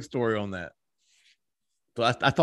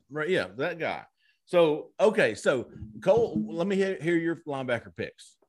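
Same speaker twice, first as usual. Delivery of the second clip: usual speaking voice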